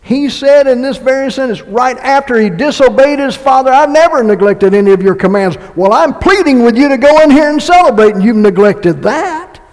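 loud, high, pressing sermon; impassioned pulpit preaching; preaching at full force. Acted. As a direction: plead with the congregation.

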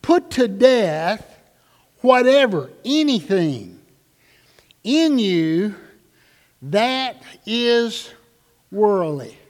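preacher drives the verse home to the congregation; grave emphatic reading. Shown as a demonstration. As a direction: let each phrase land alone. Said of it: "perhaps more seriously than you ever have".

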